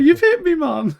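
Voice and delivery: high, loud mock cry of hurt; mock outrage. Fighter hit by his teammate, high loud mock yelp. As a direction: yelp it like a stung fighter, hammed-up.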